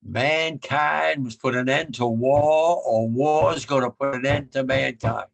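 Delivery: each word weighed slowly; slow solemn quote. Retold or imitated. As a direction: lay it out slowly and gravely. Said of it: "I remember him saying".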